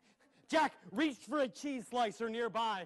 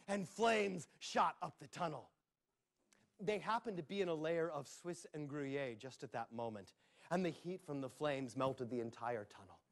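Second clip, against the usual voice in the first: ordinary delivery, nothing marked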